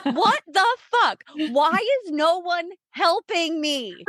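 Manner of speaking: hammed-up retold scream from the triage room. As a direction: replay the helpless scream, half in jest. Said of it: "Just, like, screaming".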